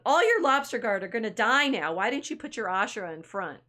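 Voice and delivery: loud, high start, acted reproach; scolding. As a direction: berate a fellow player, hammed-up.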